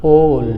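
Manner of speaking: All with a long rounded 'aw'; correct 'awl'. Shown as a demonstration. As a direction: stretch the vowel in All, 'awl'. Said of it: "क्या होगा?". (All को खींचकर 'ऑल' बोलें)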